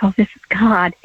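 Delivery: whispered in hushed awe; awed whisper. Retold or imitated. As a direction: breathe it out like a sudden realisation. Realising God is speaking.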